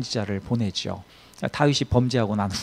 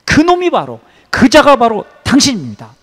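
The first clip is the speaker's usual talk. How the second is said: high, loud, acted denunciation; pointed accusation; 8 semitones higher, 9 dB louder than usual. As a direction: call out the culprit at full force. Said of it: "이렇게 지적하는 거죠".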